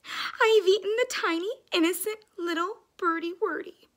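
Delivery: high, loud storybook wail; mock tearful outburst; crying dog. A narrator's reading. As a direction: lament it with hammed-up sobs. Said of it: "he cried".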